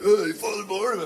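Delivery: high, loud call of a passing student; boys calling out. Retold or imitated. Boys in the hallway greet Father Barnum, calling out. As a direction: sing out a greeting to the priest down the hall.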